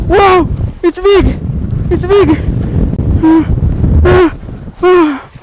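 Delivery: high, loud cries of delight; real joy as the big one surfaces; excited cheering. Real, not acted. Angler seeing his big catch, loud high cheering. Fish coming out of water.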